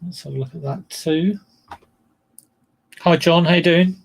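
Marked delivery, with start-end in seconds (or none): 3.0-4.0 bright, loud hello to a viewer; loud greeting. Real, not acted. Greeting John in the live chat, loud and cheery. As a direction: call out hi John, louder.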